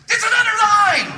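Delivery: very high, loud comic yell; mock outraged shout. Park visitor leaves one line for another, mock outraged shout. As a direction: explode in hammed-up disbelief.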